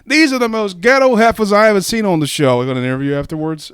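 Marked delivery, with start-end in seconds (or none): 0.1-2.6 higher, louder indignant quote; loud mock disbelief; Steve Harvey. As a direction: imitate an appalled TV host. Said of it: "Steve Harvey said".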